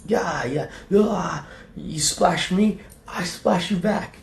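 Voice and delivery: high, drawn-out taunting voice; hammed-up imitation of people squabbling; mock brawlers. Streamer mimicking people splashing beer at each other, high mocking voice.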